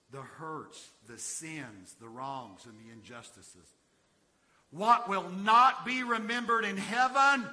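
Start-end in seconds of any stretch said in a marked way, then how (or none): 4.7-7.4 loud, high, slow question; big drawn-out preacher's cry; full-force preaching. Acted. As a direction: ring the question out to the congregation.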